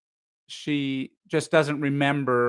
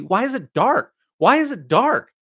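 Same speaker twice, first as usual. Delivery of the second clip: rising frightened cry of why; alarmed, climbing pitch on each question; panicked inner scream; 7 semitones higher, 6 dB louder than usual. A narrator's reading. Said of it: "thought screamingly".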